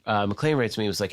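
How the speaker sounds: ordinary talk, nothing marked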